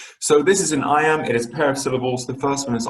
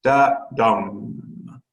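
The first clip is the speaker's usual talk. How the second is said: "Da, dum" with weak DA, strong drawn-out DUM; "Da" unstressed, "dum" held and stressed; iambic stress demo. Shown as a demonstration. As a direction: sound out the iamb, landing on "dum". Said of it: "the second is stressed".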